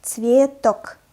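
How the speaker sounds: Цветок said slowly and loudly, both syllables full; slow demonstration. Shown as a demonstration. Teacher reads Цветок for beginners to imitate, slowly. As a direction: stretch out Цветок for learners. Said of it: "I will read the word slowly".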